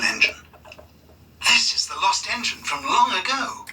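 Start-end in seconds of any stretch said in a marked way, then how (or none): 1.5-3.7 higher, amazed discovery; mild girlish exclamation; exclaimed. A narrator's reading. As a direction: gasp out a wonder. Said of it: "exclaimed Lily".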